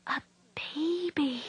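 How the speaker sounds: slow breathless whisper of delighted surprise; a soft slow whisper, amazed and glad; startled pleased whisper. A narrator's reading. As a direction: breathe it out in wonder. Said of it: "said in a startled but pleased whisper".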